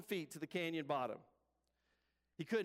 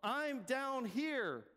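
high, eager calling voice; high-pitched plea, last words drawn out; man stranded on a cliff; 7 semitones higher, slower than usual. Acted. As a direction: call up to an unseen rescuer. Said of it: "Jack, hearing the voice, says".

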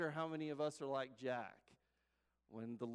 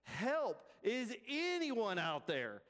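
higher-pitched storytelling cry for help; acted yell, not full force; mock yell; 4 semitones higher, much slower than usual. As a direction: call out for help, slow and drawn out. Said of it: "Jack began to yell".

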